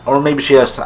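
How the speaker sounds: ordinary talk, nothing marked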